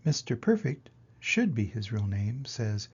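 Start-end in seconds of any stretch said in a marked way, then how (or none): none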